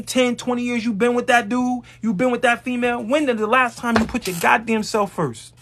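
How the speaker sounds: ordinary talk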